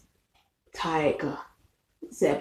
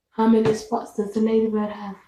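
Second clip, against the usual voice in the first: fast run-through of the question; quick repeat of the question to a toddler; 4 semitones higher, faster than usual. Acted. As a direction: rattle it off quickly. Repeats question slowly, then fast.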